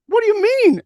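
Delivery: high startled what do you mean; wife thrown by the answer, asks sharply; surprised what. Retold or imitated. Wife reacting to husband's joke, high startled question.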